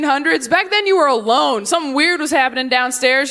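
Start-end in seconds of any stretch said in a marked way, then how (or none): none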